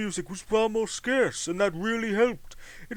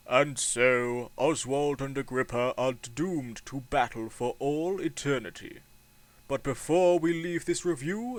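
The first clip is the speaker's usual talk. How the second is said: lower, softer closing narration; somber narrator; 6 semitones lower, 4 dB quieter than usual. Acted. Narrator closes the review, low somber voice. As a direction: wrap it up like a grim epilogue.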